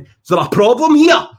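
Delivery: loud high mock-hard threat; hammed-up hard-man challenge; menacing challenge. Outside a pub to a troublemaker, loud mock-tough challenge.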